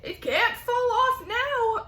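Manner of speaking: high, loud, slow shout. Acted. Aunt shouting that the peach is safe. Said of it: "Aunt Sponge shouted".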